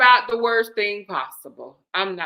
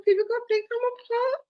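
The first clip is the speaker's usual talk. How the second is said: crying laughing; 10 semitones higher than usual. Real, not acted. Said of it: "stop making me cry".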